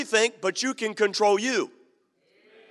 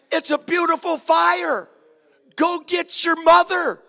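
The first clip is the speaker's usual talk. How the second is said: high, loud, delighted calls to his son; excited father; 7 semitones higher, 4 dB louder than usual. Retold or imitated. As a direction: marvel at the blaze like fireworks. Father marvels at the fire.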